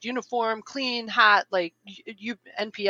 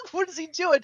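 high, laughing surprise; startled, higher, laughing; baffled, high and laughing; surprised; 12 semitones higher than usual. Retold or imitated.

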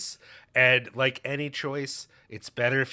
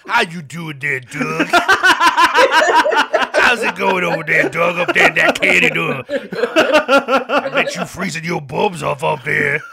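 put-on voice, higher and louder, slowing down; funny voice; 5 semitones higher, 11 dB louder, slower than usual. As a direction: ham up a silly character voice to tease his co-host. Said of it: "you're trying to do some sort of funny voice".

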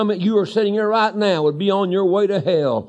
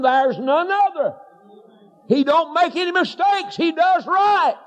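starts steady, climbs higher and higher to a shout; building preacher's cry; 7 semitones higher than usual. Acted.

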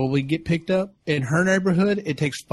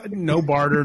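normal talking voice

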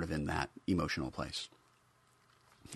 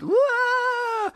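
shrill celebratory wah; high joyful whoop; 9 dB louder than usual. Retold or imitated. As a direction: let out a gleeful whoop. Whoop of freedom.

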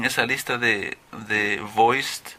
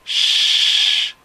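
loud, long hiss of air; sustained "shh"; 7 dB louder than usual. Shown as a demonstration. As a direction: push out a long voiceless "shhh". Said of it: "solamente es aire que sale".

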